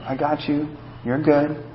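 calm a crying son gently; hushed parental reassurance; soothing a hurt child. Retold or imitated. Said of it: "I'm like".

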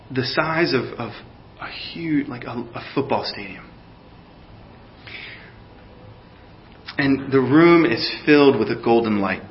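hushed guided prayer, eyes closed; guided meditation. Acted. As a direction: lead them gently into the throne room. Leads a guided imagination prayer.

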